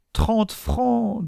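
very high, whining lament; thin high wail of dismay; plaintive. A narrator's reading. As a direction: moan the sum piteously. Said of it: "dit une voix plaintive".

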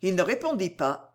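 ordinary delivery

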